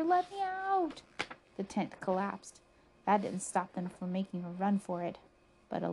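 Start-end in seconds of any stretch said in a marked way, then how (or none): 0.0-1.0 high, drawn-out wail to escape; stretched panicked cry, pitched way up; storybook scream. A narrator's reading. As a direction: cry out to be freed. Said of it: "screamed Buster".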